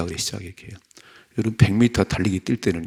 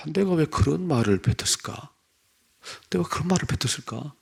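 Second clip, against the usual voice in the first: higher-pitched, imitated lament of regret; retold self-reproach; 7 semitones higher than usual. Preacher retelling a father regretting his words, imitated self-reproach. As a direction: voice a father's anguished regret, pitched up but restrained. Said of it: "아버지가 땅을 치는 거예요".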